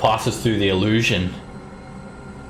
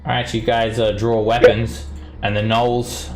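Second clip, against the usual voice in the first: usual speaking voice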